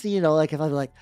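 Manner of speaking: ordinary delivery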